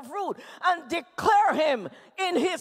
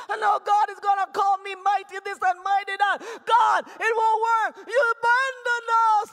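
impassioned preaching; 3 semitones higher, 4 dB louder than usual. Acted. Hurting son telling God it won't work, shouting. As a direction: pour out desperate protest.

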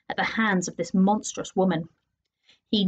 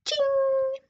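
high chime. A narrator's reading. Clock chime sound effect.

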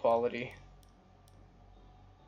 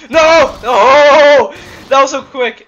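frantic high scream of dismay; 11 semitones higher, 20 dB louder, much slower than usual. Real, not acted. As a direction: scream it, losing the round. Gamer screams at a near-loss.

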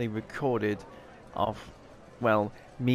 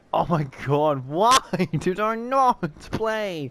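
exasperated laughing; 4 semitones higher, 6 dB louder than usual. Real, not acted. Missed a winning hand.

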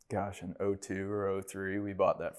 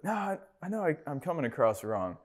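starts much higher, then settles, apologetic; a quick high backtrack, then calmer; backpedaling stranger; 8 semitones higher than usual. Retold or imitated. Driver apologizes to the window washer, high backtracking voice. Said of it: "He's like".

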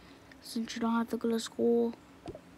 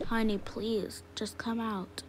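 ordinary delivery

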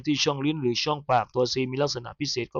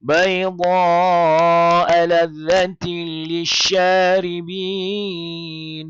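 melodic chanted recitation, letters spelled out in tune; Quran recitation; 4 semitones higher, 4 dB louder than usual. Acted. Recites the verse after.

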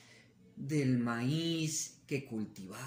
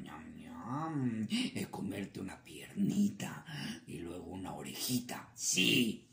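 lightly voiced coyote, whispered relish on the bites; gloating coyote; 6 semitones lower than usual. Coyote describing how he'll eat the rabbit, mild relish. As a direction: savor the threat.

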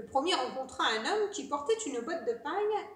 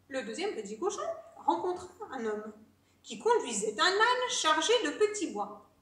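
ordinary delivery

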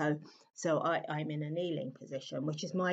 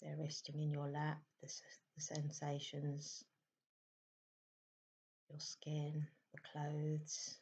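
soft, unhurried meditation voice with long pauses; hushed, calm meditation guidance; guided meditation; 6 dB quieter than usual. Acted. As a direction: gently guide listeners into stillness, leaving space between phrases. Leads a meditation.

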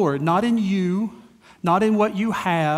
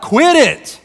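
loud, high imitated parental shout; mom's yell; 7 semitones higher, 9 dB louder than usual. Mom yelling at kids hitting each other, fed-up shout. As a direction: snap loudly as a mom out of patience. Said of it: "she just yells out".

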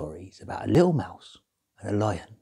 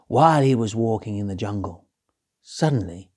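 ordinary delivery, nothing marked

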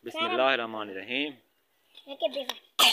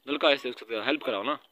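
ordinary talk, nothing marked

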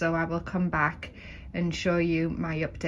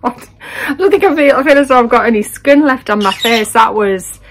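much louder, still windswept; 3 semitones higher, 13 dB louder than usual. Real, not acted. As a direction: talk loud after the wind. Just back from windy beach.

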